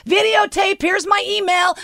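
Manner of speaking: high, urgent retold call; mock yell to passers-by, pitch up; half-strength scream for help. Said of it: "I'm screaming to people".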